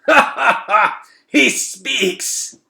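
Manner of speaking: high, loud mocking laughter; laughing rat. Shed laughing at the boy daring to speak, high and loud. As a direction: laugh at the little morsel. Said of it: "Fangor and Shed laughed".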